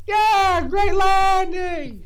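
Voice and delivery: high loud slow cheer. Retold or imitated. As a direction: cheer like a proud fan. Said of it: "He's like".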